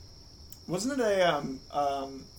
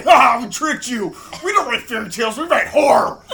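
loud, laughing taunt; gloating laugh; 5 semitones higher, 9 dB louder than usual. Acted. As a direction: crow over the tricked parents. Mocking gloat to the parents.

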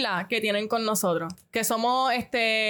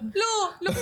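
put-on child's higher call, laughing; imitating a toddler; 7 semitones higher than usual. Mother imitating her son asking for the light, childlike voice. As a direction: mimic the little boy pointing at the light. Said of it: "Mauricio apuntaba a la luz".

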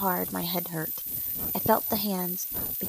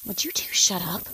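louder, exasperated demand; fed-up outburst, narrated; angry; 5 dB louder than usual. A narrator's reading. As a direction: cut into their bickering. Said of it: "I was becoming angry".

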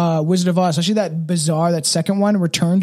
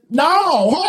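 mock laughing reply; 10 semitones higher than usual. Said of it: "with a laugh".

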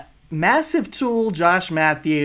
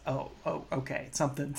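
much softer, restrained seething calm; quiet anger; 13 dB quieter than usual. Retold or imitated. Imitating a furious parent who stays eerily calm, hushed quiet anger. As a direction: let the anger simmer under a hush. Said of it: "a real quiet anger".

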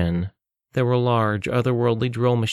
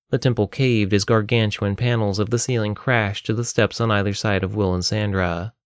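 plain reading voice

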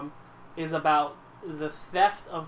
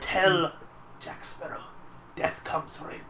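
creepy villain whisper; 6 dB quieter than usual. Retold or imitated. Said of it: "say it like you're underwater a little bit more".

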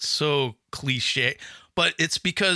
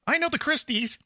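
higher sweet woman's voice; a hint of a sweet old lady; lightly voiced helpful lady; 13 semitones higher than usual. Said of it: "She's like".

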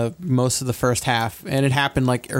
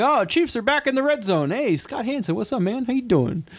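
high-pitched, playful hail to a TV host; upbeat, high mock-chummy hello; excited greeting to the TV; 8 semitones higher than usual. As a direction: greet the screen like an old buddy, pitched up.

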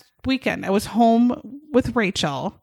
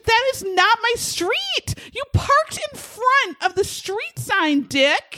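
mock indignant; 12 semitones higher, slower than usual. Retold telling off stranger.

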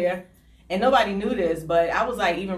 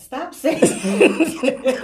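very slow, stretched scolding through laughter; drawn-out scold; 7 semitones higher, 7 dB louder, much slower than usual. Real, not acted. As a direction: draw every word out to stop her. Scolding daughter Bella.

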